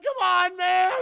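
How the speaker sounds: high, loud whining cry; mock wailing complaint. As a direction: wail it like a robbed old man. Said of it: "He's like".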